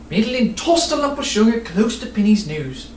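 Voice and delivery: plain reading voice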